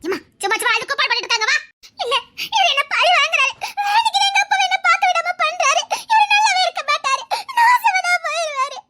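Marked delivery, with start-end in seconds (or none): 2.6-8.8 high, tearful wail. Acted. Daughter begging to see her father, wailing. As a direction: cry out in despair, dragging the words. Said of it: "கதறுதா".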